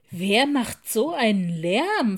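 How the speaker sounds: laut und empört rufen; ärgerlich in den Raum rufen; empörter Ruf. Acted. Frau Pepper empört über Lärm. (Bibliothekarin ruft empört wegen des Lärms, laut)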